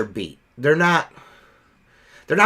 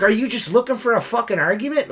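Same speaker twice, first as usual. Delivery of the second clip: higher-pitched, combative question; retold cussing-out; 7 semitones higher than usual. Window cleaner confronting rude customer, retold cussing-out. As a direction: act out squaring up for an argument, voice raised in pitch. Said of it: "I was cussing at this motherfucker".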